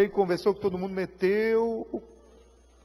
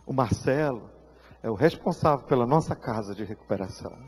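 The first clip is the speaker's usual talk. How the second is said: normal talking voice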